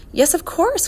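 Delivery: high, eager agreement; excited. A narrator's reading. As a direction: jump in excitedly with sudden recognition. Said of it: "cried Ben excitedly".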